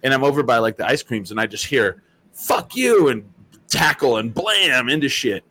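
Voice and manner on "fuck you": sudden high shouted curse; yelled curse. Retold or imitated. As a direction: bark it like a brawler mid-fight. Shouted during fight in store.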